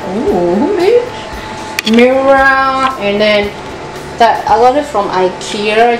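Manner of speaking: usual speaking voice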